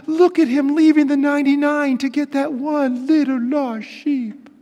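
sentimental coo. Retold or imitated. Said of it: "and we think".

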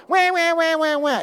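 mock baby wail. Mock crying.

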